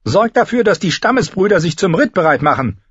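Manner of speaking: high, forceful character voice; commanding lord. Acted. Tyrion giving battle orders.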